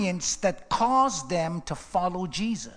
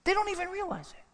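high quick aside; 11 semitones higher, 5 dB quieter, faster than usual. Acted.

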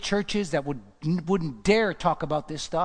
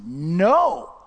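loud, firm "No"; emphatic refusal; 7 dB louder than usual. Acted. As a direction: hit "No" hard as a final refusal. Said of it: "It's emphatically saying".

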